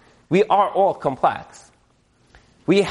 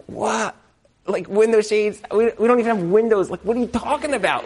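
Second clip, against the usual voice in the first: higher, quickening mock bewilderment; imitated baffled husband; 4 semitones higher than usual. Husband baffled by talk of window shades, higher incredulous voice. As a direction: act out a husband's disbelief, pitched up.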